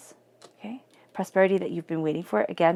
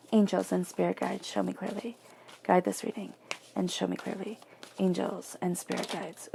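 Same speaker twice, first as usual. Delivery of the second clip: soft invocation; 8 dB quieter than usual. Acted. Reader quietly invoking angels before the tarot spread. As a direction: call softly on the spirit guides.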